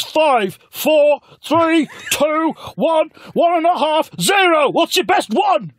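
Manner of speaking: very high, loud, slow shouted countdown; mock countdown. Corbyn counts down at the narrator in a high shout. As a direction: shout the countdown like a game show.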